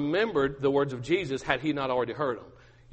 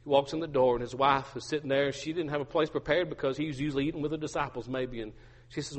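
usual speaking voice

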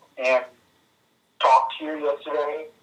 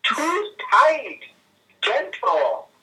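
high-pitched mock yelp easing into complaint; whiny spoiled brat; 5 dB louder, much slower than usual. Friend voices Daisy objecting to being touched, whiny spoiled brat. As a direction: play a pampered dog protesting, pitched way up. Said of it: "she's such a whiny, spoiled brat".

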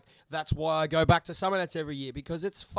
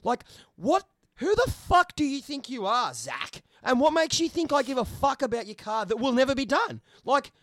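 high, loud frustrated rant; angry rant; 9 semitones higher, 5 dB louder than usual. Real, not acted. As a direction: tear into the commenter, voice climbing. Ranting angrily at commenter.

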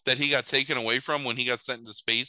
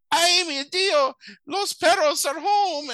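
high, loud hammed-up Mexican accent; slow, loud mock Mexican vendor; 5 dB louder than usual. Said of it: "he's like".

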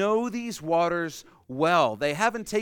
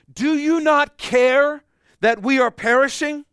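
high, loud desperate plea to a sleeping teacher; panicked cry; 11 semitones higher, 11 dB louder than usual. Acted. Disciples panicking in the storm call to Jesus, frantic loud cry. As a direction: shout it up over the storm, frantic.

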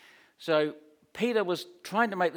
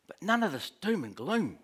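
higher, pushy salesman pitch to Jesus; Peter pleading like a campaign adviser; 5 semitones higher than usual. Retold or imitated. Peter coaxing Jesus aside, higher and eager. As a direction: sell him the positive spin, eagerly.

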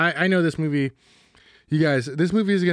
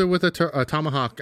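ordinary talk

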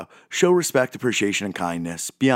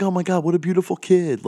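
higher, brighter gushing compliment; friendly inflection; 10 semitones higher, 3 dB louder than usual. Shown as a demonstration. To parents about their cute baby, a friendly gushing voice. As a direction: coo it warmly, like you mean it. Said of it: "put an inflection in your voice that is a little bit more friendly".

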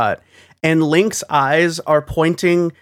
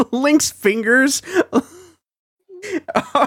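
laughing; 9 semitones higher, slower than usual. Real, not acted. Laughing describing bad cutscenes.

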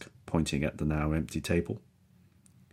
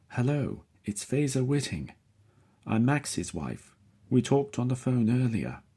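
higher, polite woman's voice; Faiza's voice; 8 semitones higher than usual. A narrator's reading. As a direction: lift the voice for Faiza, courteous. Faiza at the intercom.

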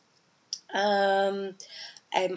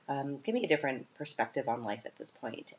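normal talking voice

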